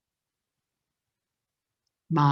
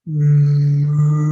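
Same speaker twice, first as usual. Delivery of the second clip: low, loud cow moo; deep moo; 4 semitones lower, 10 dB louder than usual. Acted. Straining puppy finally mooing, low and loud. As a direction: push out a big cow moo. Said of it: "and he said".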